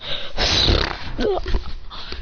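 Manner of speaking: long voiceless exhale; drawn-out breathy sigh; acted long sigh. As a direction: sigh heavily in character. Character sighs in story.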